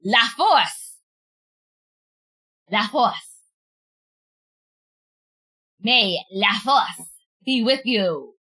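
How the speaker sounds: La Force said in French, Force as fors without the r; French attempt. Shown as a demonstration. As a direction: try La Force the French way, then slowly joke May La Force be with you. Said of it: "I'm trying to say it properly".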